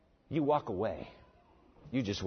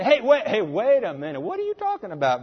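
startled woman; 5 semitones higher, 5 dB louder than usual. Acted. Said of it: "And the woman said".

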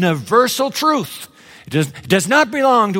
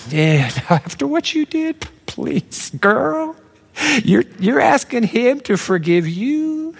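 mocking scoff; 5 semitones higher, slower than usual.

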